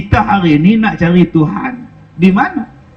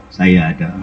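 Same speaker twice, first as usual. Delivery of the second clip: much lower, dry deadpan reply; imam's answer. Retold or imitated. Imam answering the widow's plea, low and deadpan. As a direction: drop low and answer flatly.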